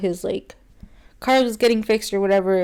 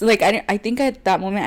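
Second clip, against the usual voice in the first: usual speaking voice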